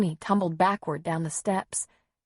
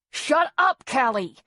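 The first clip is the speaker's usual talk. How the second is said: high, loud sharp order; barking; 6 semitones higher, 5 dB louder than usual. A narrator's reading. Father snapping at sobbing daughter, barked order. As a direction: bark it at the child. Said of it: "he barked".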